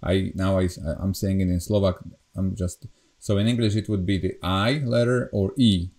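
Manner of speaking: ordinary delivery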